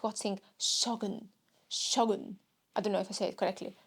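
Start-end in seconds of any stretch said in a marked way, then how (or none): none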